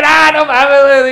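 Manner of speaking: loud, high retold disbelief at his girlfriend; retold exasperated shout. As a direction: blurt it like you can't believe it.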